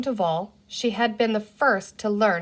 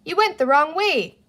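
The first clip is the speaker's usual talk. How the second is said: loud, raised call across the water; higher, louder call, still across the river; shouting; 7 semitones higher, 5 dB louder than usual. A narrator's reading. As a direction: keep yelling over to her. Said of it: "Penny shouted at her".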